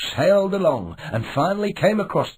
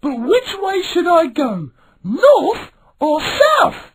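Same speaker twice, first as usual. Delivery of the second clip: put-on young pirate voice; 12 semitones higher, 4 dB louder, slower than usual. A narrator's reading. Milo asks the listener.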